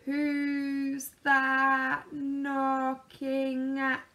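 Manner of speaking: very slow, drawn-out call from upstairs; slow sleepy call. A narrator's reading. Mister Slow calling down to Mister Busy, very slow. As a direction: stretch every word like a sleepy slowcoach. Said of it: "he called downstairs".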